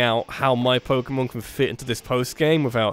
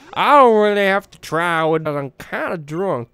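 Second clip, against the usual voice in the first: lazy southern American accent; 4 dB louder than usual. Shown as a demonstration. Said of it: "it goes to a southern accent".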